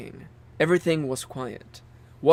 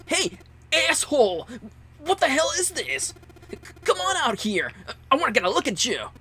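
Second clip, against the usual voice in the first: angry shout; 12 semitones higher than usual. Acted. Junpei yells at the speaker.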